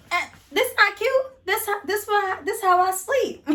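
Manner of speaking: put-on feigning innocence to her boyfriend; playing dumb. Playing innocent about girdle.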